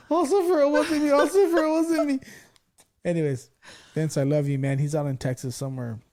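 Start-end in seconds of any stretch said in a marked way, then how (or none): 0.1-2.2 high, loud, whiny sobbing plea; hammed-up blubbering; mock crying plea. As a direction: wail it like a scared crybaby. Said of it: "And he goes, he goes".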